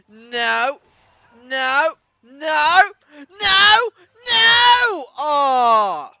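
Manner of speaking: high loud shouts of no; yelling no. Real, not acted. Gamer watching himself lose, loud dismayed shouts.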